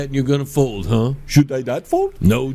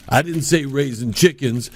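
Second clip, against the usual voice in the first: ordinary delivery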